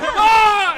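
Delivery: a sudden high, loud mock scream; imitated scream. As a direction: yelp it out like a man on fire. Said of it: "And he just starts screaming".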